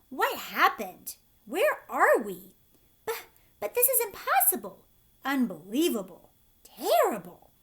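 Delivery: horrified disbelief; shocked. A narrator's reading. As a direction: gasp it out in dismay. Creatures shocked by the sea.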